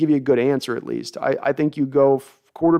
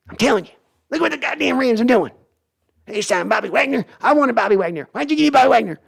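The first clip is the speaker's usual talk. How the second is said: high, far-off mock cranky old owner; Jerry Jones impression; 9 semitones higher than usual. Jerry scolds his son over signings, high mock rant. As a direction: rant like a stubborn Texan boss. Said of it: "Jerry and Sons impression".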